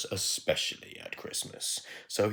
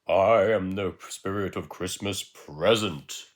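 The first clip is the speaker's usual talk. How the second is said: louder, slow spirit announcement; a hint of a grand jolly spirit; lightly jolly spirit; 8 dB louder, slower than usual. Jolly carrot spirit speaks.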